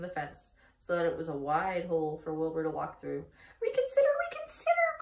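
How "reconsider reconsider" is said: very high, fast honking cry; frantic goose. A narrator's reading. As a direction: rattle it off like a goose. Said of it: "cried the goose".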